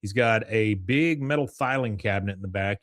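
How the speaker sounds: ordinary delivery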